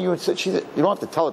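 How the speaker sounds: ordinary talk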